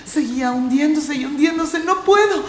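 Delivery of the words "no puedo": high, loud desperate cry. Acted. Core struggling in the sand, high desperate cry. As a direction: give up in fear.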